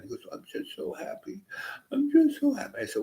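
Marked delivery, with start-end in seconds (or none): 0.3-1.4 breathy whispered tearful sob of joy; half-whispered, choked up with happy tears; imitating friend crying. A man crying on the bus tells his friend he's happy, whispered through tears. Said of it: "he was crying". 1.9-2.8 squeaky, much higher wailing sob; imitating friend crying. A man crying on the bus tells his friend he's happy, high tearful wail. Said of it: "he was crying".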